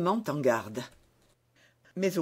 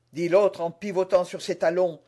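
ordinary delivery

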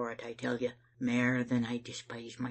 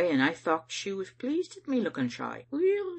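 ordinary delivery, nothing marked

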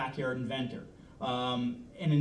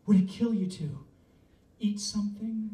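higher, loud fussing woman; a fussing woman offering food, louder; nagging girlfriend; 6 semitones higher, 4 dB louder than usual. Acted. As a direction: coax him to eat, higher and louder. Woman pushing a sandwich on him.

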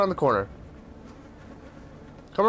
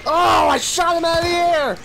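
higher-pitched excited cry; excited shout; 6 semitones higher than usual. Real, not acted. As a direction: exclaim in amazed triumph. Exclaims at a lucky hit in game.

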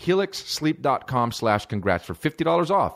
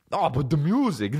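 higher, goofy mock protest; music buddy impression; 5 semitones higher than usual. Buddy protesting to comic about the song, mock whine. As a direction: whine like the caricatured friend.

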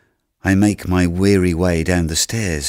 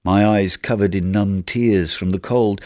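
ordinary delivery

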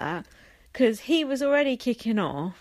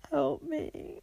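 mock despair; 7 semitones higher, 7 dB quieter than usual. Mock despair at the new housemates.

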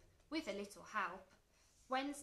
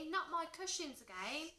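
higher-pitched cry of dismay; 7 semitones higher than usual. A narrator's reading. George's mum about her cushions, crying out in dismay. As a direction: cry out in mild exasperation. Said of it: "cried George's mum".

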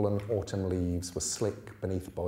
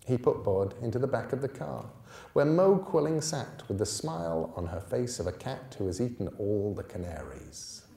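usual speaking voice

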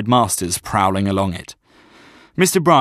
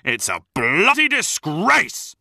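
slow, higher cry of disgust; outraged bellow; 8 semitones higher, slower than usual. Acted. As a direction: stretch out the outrage across the pitch. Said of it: "bellowed my dad".

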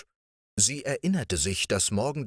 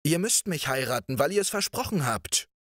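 lightly voiced prince, higher and insistent; 8 semitones higher than usual. A narrator's reading. Riquet insists the princess must marry him, rising high. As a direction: press the promise on her. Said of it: "rief er dagegen".

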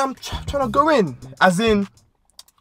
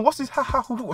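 mock puzzled murmur; 6 semitones higher, 7 dB quieter than usual. Fading mock moans in thought.

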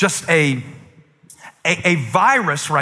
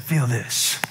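soft dad; 4 semitones lower, 6 dB quieter than usual. Retold or imitated. Dad soothing scared daughter.

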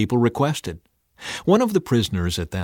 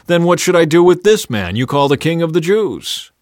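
loud, high challenge to a crowd; raised-voice question; 7 semitones higher, 8 dB louder than usual. A narrator's reading. Pilate asking the crowd, raised voice. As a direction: put the question loudly to everyone.

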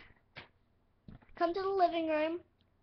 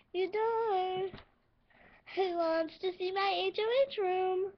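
normal talking voice

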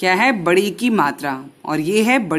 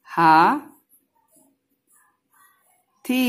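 ordinary talk, nothing marked

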